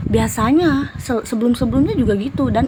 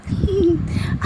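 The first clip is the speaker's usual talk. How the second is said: high imitated jinn giggle; retold jinn giggle; mocking giggle; 8 semitones higher than usual. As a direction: giggle like the teasing spirit. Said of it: "Langsung dia tuh ketawa".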